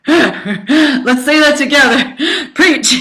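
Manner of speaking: talking through real laughter; laughing. Real, not acted. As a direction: laugh while cheering yourself on. Laughing at her own line.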